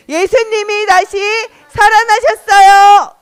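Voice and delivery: loud, high, stretched ending; acted jubilant proclamation; proclaiming. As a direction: call out the news, drawing out the last word. Repeats the proclamation with children.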